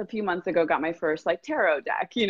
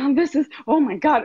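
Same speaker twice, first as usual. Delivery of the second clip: higher, louder gasping rush; breathless thrill; 8 semitones higher, 5 dB louder than usual. Retold or imitated. Client feeling her vision land, gasping with thrill. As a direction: gasp it out, overwhelmed with excitement. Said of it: "see her body be like".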